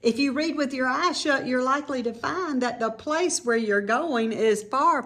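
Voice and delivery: ordinary delivery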